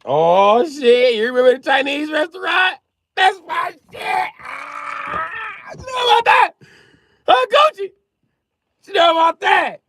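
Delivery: very high, loud squealing shouts; over-excited high-pitched hollering; excited yelling. Real, not acted. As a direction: shriek with delighted recognition. Thrilled at viewer remembering restaurant.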